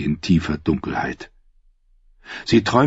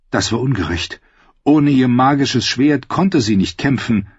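ordinary delivery

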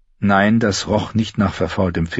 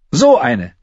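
put-on higher, louder girl's retort; Arya's voice; 7 semitones higher, 6 dB louder than usual. Girl retorts while kicking him, higher and louder. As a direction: kick him with the words.

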